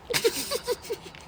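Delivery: squeaky burst of laughter; high-pitched giggle. Real, not acted. Laughing at own joke.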